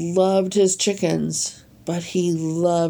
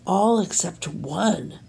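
ordinary delivery, nothing marked

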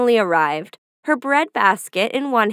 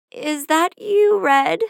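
put-on higher, slow old woman calling to the door; old grandmother asking through the door, pitched up; 6 semitones higher, slower than usual. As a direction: call out frail and slow from bed. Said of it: "called a voice from inside".

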